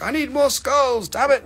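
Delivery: hammed-up whining buyer; mock demanding customer. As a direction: pitch it up like a petulant collector. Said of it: "You're like".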